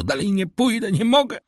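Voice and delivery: high, rising panicked plea; frightened refusal. A narrator's reading. Terrified steward refuses to go on, high. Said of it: "zawołał Bertuccio".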